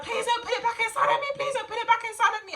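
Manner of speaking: high, fast desperate begging; frantic plea through pain; pleading in pain. Retold or imitated. Begging nurse during painful exam.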